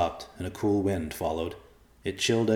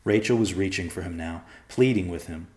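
usual speaking voice

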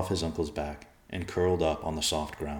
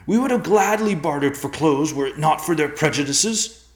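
much higher and louder anguished tirade; furious outburst; 11 semitones higher, 9 dB louder than usual. A narrator's reading. Son rails to his father against the men, furious. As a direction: rail against injustice at full force.

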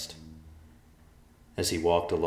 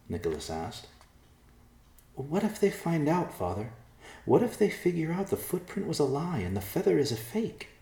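higher, anxious daughter; anxious young woman; 10 semitones higher than usual. A narrator's reading. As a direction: let the worry pile up. Worried daughter pleads to father.

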